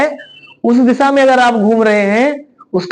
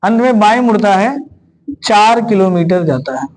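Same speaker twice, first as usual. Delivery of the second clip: slow, paused dictation for students to write; feed the class the question phrase by phrase; 3 semitones lower than usual. Shown as a demonstration.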